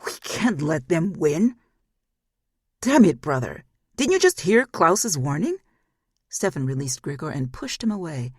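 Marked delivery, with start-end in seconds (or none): 0.0-1.6 whimpering. Acted. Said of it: "Grigor whimpered like an injured animal". 2.8-5.6 loud, higher angry retort; shouting in exasperation at a foolish brother; angry rebuke. Acted. Stefan angrily rebukes Grigor.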